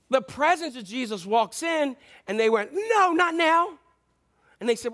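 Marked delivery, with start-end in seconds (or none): 2.7-3.7 high, hammed-up wail of dread; mock panic at an unwelcome arrival; freaking out. Demons as Jesus walks in, freaking out. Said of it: "They were freaking out".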